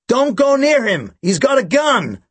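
high, drawn-out alarmed shout; shouted warning. A narrator's reading. Bank woman warning the security guard about the gun, shouting. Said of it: "she shouted out".

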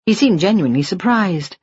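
plain reading voice

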